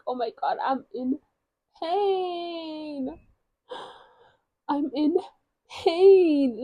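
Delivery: hammed-up wail, rising high on PAIN; whimper, then draw out a high mock wail; dramatic crying in pain. Retelling crying with a stinging face mask on, melodramatic wail. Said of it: "tell me how dramatic you are".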